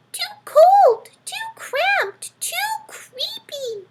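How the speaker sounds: very high, tiny squeaking mouse voice; tiny mouse whining about his home; squeaky mouse. Acted. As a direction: squeak it out like a fussy little mouse. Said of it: "mouse complains".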